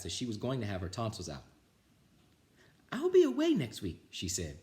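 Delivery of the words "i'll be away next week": high, bright teacher's voice raised. Acted. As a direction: sound like the kindly teacher announcing. Teacher voiced in read story.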